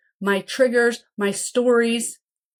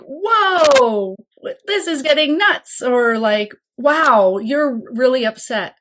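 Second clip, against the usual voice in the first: startled whoa; 4 semitones higher, 5 dB louder, slower than usual. Retold or imitated. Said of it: "So if you're like".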